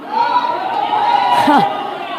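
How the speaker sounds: high shrill burst of laughter; shrieking laugh. Retold or imitated. Preacher voicing gossiping woman at funeral, shrieking laugh. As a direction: let out a high squealing cackle.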